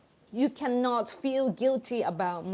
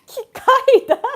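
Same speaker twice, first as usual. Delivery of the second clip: high, loud shriek through laughter; helpless laughter; 13 semitones higher, 13 dB louder than usual. Real, not acted. Streamer getting the pun on a superhero's name, helpless laughter. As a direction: burst out with it, cracking up.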